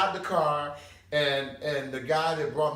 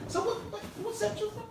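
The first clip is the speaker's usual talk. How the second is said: much higher playful voice; mock street voice. Speaker playfully asking gangbangers which set they're from. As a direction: ham up the street question. Mimicking street talk to gang members.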